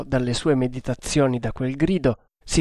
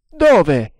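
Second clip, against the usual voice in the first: loud, raised demand; shouted question; 5 semitones higher, 7 dB louder than usual. A narrator's reading. Asking the lookout where the ship is, shouting. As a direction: fire the question up the mast.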